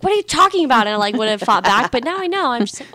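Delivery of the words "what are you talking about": higher, mildly indignant comeback; mock mad retort. Daughter imagines fighting back at mom, mildly mad. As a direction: play at being mad, voice jumping up. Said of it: "I would have been mad".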